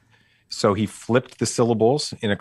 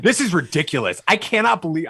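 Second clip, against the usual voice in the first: mock stern dad; 8 semitones higher than usual. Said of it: "I'm, like, whispering this into his ear".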